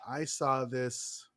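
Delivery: normal talking voice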